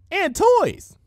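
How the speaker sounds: high, loud and bubbly on toys; bright Pinky voice. A narrator's reading. Pinky proudly finishing her list for Dash, high and bubbly. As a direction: land toys with a happy bounce. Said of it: "Pinky said brightly".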